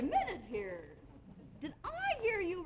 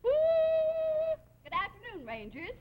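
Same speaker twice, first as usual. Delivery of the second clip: loud, drawn-out, much higher pompous hail; booming greeting; 11 semitones higher, 6 dB louder, much slower than usual. Acted. Pack leader booming a slow greeting at the rangers. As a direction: stretch it out and boom it like a drill leader.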